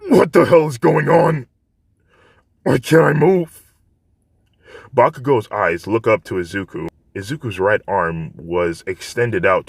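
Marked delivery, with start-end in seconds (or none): 0.1-3.7 much higher, louder panicked anger; angry confusion. Acted. Bakugo pinned down and unable to move: alarmed angry shout. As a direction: rage against being unable to move.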